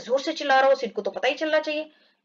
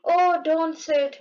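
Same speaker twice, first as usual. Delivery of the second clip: mock pain; 4 semitones higher, much slower than usual. Tom in pain pleading.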